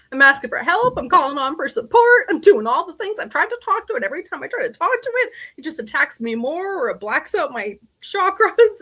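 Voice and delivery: high, mounting mock desperation; exasperated plea. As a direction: pile up complaints, high then easing down. Retold desperate plea to mentor.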